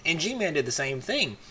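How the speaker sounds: normal talking voice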